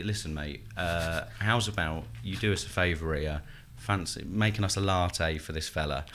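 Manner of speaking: matey cajoling. Acted. Contestant asks the barista a favour, low matey cajoling. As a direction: coax him in a low, casual voice.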